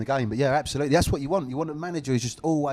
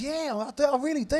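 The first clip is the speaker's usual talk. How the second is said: much higher, put-on Australian accent; Joe Montemurro; 12 semitones higher than usual. Manager answering whether he watches the podcast, Australian accent. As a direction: imitate an Aussie coach, pitched right up. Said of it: "Sorry about the accent".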